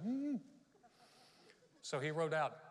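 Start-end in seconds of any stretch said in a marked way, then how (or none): 0.0-0.4 high, rising wordless hum; mute humming. Acted. Silenced Zacharias trying to make himself understood, high hum. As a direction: protest through a closed mouth. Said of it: "he still could talk. He's like".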